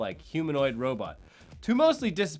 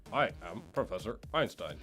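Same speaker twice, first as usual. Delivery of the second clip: flat robotic computer voice, lower and softer; text-to-speech voice; 6 semitones lower, 8 dB quieter than usual. Retold or imitated. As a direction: drone it like cheap speech software. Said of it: "sounds like one of those text-to-speech voices".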